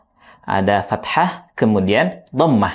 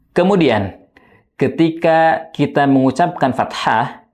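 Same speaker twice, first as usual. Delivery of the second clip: ordinary delivery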